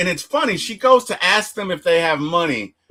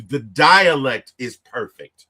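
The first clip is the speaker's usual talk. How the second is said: normal talking voice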